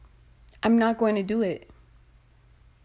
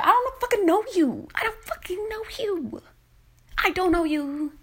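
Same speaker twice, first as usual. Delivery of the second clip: exasperated shout; 8 semitones higher than usual. Real, not acted. Outraged at a stranger who presumes, yelling high. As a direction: yell it at the stranger.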